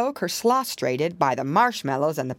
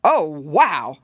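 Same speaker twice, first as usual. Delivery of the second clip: louder exclamation of surprise; startled cry; 3 semitones lower, 6 dB louder than usual. A narrator's reading. Rabbit seeing a bear at the window, startled cry. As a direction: cry out in mild alarm. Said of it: "cried Uncle Wiggily".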